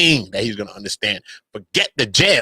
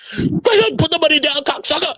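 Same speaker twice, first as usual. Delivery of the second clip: high crying take; 14 semitones higher than usual. Shown as a demonstration. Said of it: "Everybody gonna say it different".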